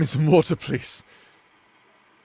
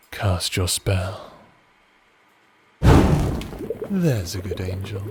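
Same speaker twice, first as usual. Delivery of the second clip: low, slow seductive purr; sultry witch; 5 semitones lower, slower than usual. Acted. Coaxing partner to cast the heat spell, low slow sultry purr. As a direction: tease like a wicked enchantress.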